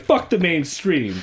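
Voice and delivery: slow, high punky British voice; British accent. Retold or imitated. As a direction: sneer it like a punk Brit kid. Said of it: "You know, my British accent".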